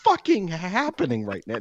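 high, strained disbelief; incredulous panic. Retold or imitated. Inner voice after the woman's accusation, high incredulous panic. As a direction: panic at the absurdity. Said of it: "And I'm like".

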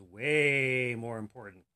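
"Way" drawn out slowly and loudly; emphatic stretched WAY. Acted. Cop topping the chief's guess, "Way" stretched for emphasis. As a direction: lean long and hard on "Way".

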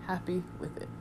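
soft murmured repeat; quiet echo. Real, not acted. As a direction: say it again under your breath. Quiet echo of previous line.

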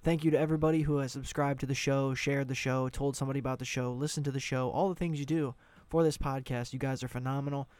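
ordinary delivery

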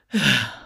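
long weary sigh out loud; husband's fed-up sigh. Retold or imitated. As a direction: sigh like a worn-out husband hearing the plan. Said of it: "and then my husband goes".

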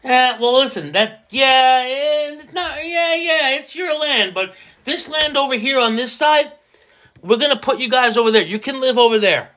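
mock settler voice. Mock settler fobbing off the tribe.

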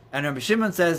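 usual speaking voice

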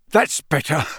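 out-of-breath relief; panting. A narrator's reading. Man freed from fireplace, panting. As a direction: puff it out between breaths. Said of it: "panted Mister Weasley".

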